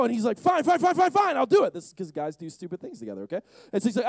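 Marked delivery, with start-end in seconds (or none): 0.4-1.7 high, rattled string of fines; exasperated surrender. Acted. Peter caving to the other disciples, exasperated. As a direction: give in under protest. Peter giving in under pressure.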